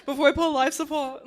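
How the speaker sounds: loud brogue, barely holding it together; laughing Irish accent. Pretend. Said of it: "your accent's evolving".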